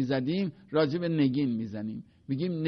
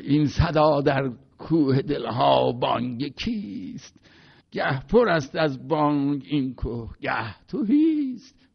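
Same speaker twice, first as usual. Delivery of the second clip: put-on Jafari's Turkish accent. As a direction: recite like an elderly Azeri master carried away by the poem. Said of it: "این حالت مرحوم جعفری بود".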